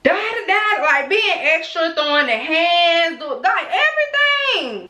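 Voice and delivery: high-pitched mock yell of a ranting boss; retold yelling. Boss ranting at worker by his desk, mock yelling. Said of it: "he just yelling".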